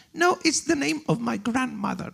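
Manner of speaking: imitating the translator. Translator's punchline retold.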